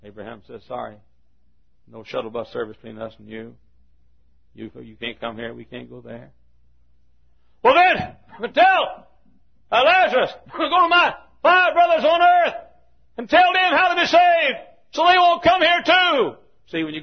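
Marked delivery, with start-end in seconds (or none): 0.7-6.3 dry refusal. Acted. Abraham refusing the rich man in hell, quiet dry refusal. 7.6-16.4 high, shouted plea; desperate plea. Acted. Rich man begging Abraham for his brothers, desperate shout.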